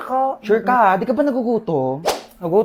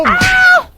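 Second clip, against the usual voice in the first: high, loud yelp; sudden scream; 5 dB louder than usual. Acted. Character suddenly screams in bedroom skit. (biglang tili)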